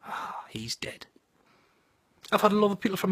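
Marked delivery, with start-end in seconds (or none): 0.0-1.1 slow, breath-only whisper; hushed whisper. Acted. Finding the junkie dead that night, hushed whisper. Imagined find after a night's sleep.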